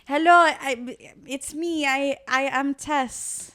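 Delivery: hammed-up French accent, high and slow. Host putting on a silly French accent for her sister. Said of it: "It's very French".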